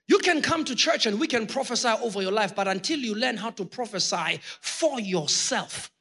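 usual speaking voice

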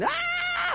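sudden high shriek of panic; mock scream. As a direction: shriek like a terrified churchgoer. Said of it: "the Christians are gonna be screaming".

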